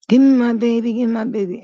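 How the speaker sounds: high, imitated pleading cry; desperate plea. Mother begging the father for her baby, high mock plea. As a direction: beg for the baby back, pitched high. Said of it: "She started screaming".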